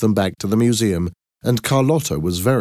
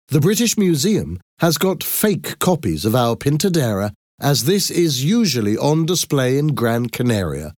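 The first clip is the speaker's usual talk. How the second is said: higher-pitched address to a crowd; exhibition presenter; 6 semitones higher than usual. Acted. Thomas explains the exhibit to the crowd, formal presenting. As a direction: present it proudly to an audience, pitched up.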